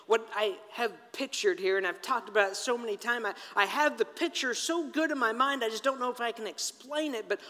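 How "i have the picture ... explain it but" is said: ordinary talk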